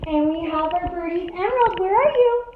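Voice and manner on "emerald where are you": high, loud call to the bird. Real, not acted. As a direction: call the bird from across the room. Calling out for her bird.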